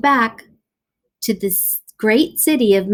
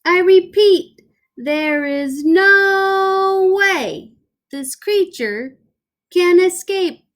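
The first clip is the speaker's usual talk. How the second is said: higher, slow and deliberate; emphatic repeat; 9 semitones higher, slower than usual. Acted. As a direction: spell it out slowly for the crowd. Doctor repeats slowly.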